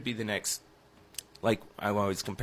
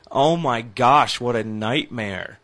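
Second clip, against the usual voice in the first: exasperated; 8 dB louder, slower than usual. Real, not acted. Exasperated about his team.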